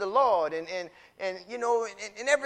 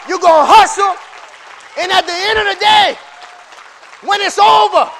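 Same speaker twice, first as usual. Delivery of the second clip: loud, high shouted preaching; full-force preaching; 6 semitones higher, 12 dB louder than usual. Acted. Preacher shouting over the congregation, full force. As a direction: whip the church up, shouting.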